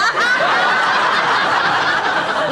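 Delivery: high breathless giggling laugh; real laughter bursting out; helpless laugh. Real, not acted. Teller laughing at her mother's hymn trick.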